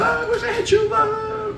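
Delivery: high, slow, drawn-out wail; mock lovesick woman. Comic mocking lonely women with bonbons, high and wailing. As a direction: swoon it like a heartbroken lady.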